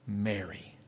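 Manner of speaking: very low, hushed single word; intimate, softened retelling; softly calling her name. Retold or imitated. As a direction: say the name quietly, after a pause. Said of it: "then he says to her".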